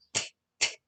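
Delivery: "T" as a short, quiet, voiceless tongue tap; soft isolated consonant clicks; sounding out T. Shown as a demonstration. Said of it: "La lengua entre los dientes".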